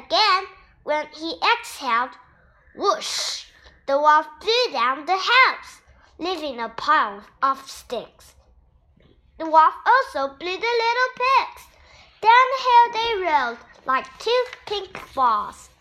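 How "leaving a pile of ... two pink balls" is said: ordinary delivery, nothing marked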